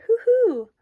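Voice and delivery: high, loud owl hoot; owl hollering. A narrator's reading. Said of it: "who hollered".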